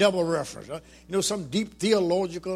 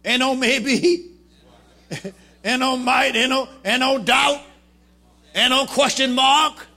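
high, loud, slow preaching build; fervent preaching; 6 semitones higher, 9 dB louder, slower than usual. Acted. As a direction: hammer it home from the pulpit. Preaching at full force.

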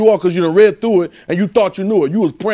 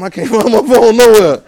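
louder, higher, drawn-out complaint; mock frantic yell; 5 semitones higher, 7 dB louder than usual. Preacher acting out hunting for his phone, mock frantic yell. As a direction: play up a loud, slow wail over a lost phone. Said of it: "screaming for something".

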